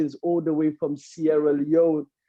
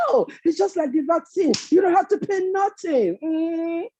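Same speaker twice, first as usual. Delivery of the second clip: hyena; 10 semitones higher than usual. Acted. Hyena's reply in folk tale.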